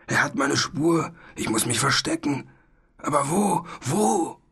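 rising panic, climbing high on the last words; frantic dragon searching for a hiding place; desperate. A narrator's reading. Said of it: "Verzweifelt wendete er den langen Hals".

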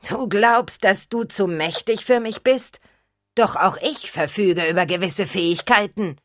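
high, menacing undead threat; sinister vampire voice. Acted. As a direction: gloat like a monster.

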